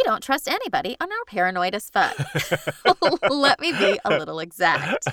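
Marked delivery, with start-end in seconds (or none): 2.9-5.1 words broken up by laughter; laughing while reading. Real, not acted. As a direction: read it through giggles. Reader cracks up, then rereads line.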